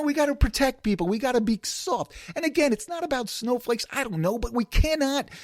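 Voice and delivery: normal talking voice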